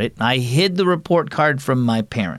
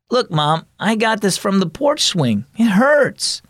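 put-on higher boy's voice, rising to a whine; young boy complaining; 10 semitones higher than usual. Boy showing his mom his hurt finger, higher and whiny. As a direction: play the kid wanting sympathy.

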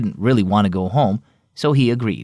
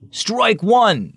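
umpire calls the strike, voice raised high; umpire calling out; 10 semitones higher than usual. A narrator's reading. Umpire calling a strike at home plate, raised voice. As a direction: sing out the call like an umpire. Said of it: "the umpire called".

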